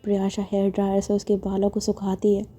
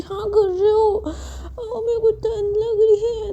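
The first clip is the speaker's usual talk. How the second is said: put-on much higher, slow tearful child voice; childish whine; 12 semitones higher, slower than usual. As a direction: whimper like a shivering kid. Maya whines while toweled.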